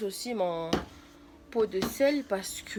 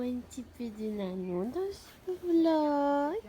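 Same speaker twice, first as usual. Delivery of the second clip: whispering over a crib; 5 semitones higher, slower than usual. Acted. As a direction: draw it out tenderly over the sleeping dog. Repeats the coo to the dog.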